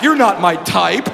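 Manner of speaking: slow, flat put-down; dry comeback. Pretend. Comedian's imagined comeback to the phobic woman, slow and dry. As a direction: deliver it slow and deadpan.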